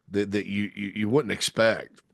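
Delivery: ordinary talk, nothing marked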